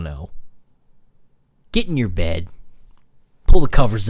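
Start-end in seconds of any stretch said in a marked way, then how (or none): none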